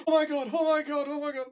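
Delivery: high, panicked repeated pleading; high-pitched mock panic, fading in volume at the end; terrified mother. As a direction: imitate someone frozen with fear of heights. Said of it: "absolutely terrified of heights".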